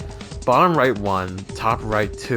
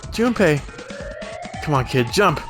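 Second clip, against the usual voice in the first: urgent call; 4 semitones higher than usual. Acted. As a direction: shout his name across. Character calls to Junpei.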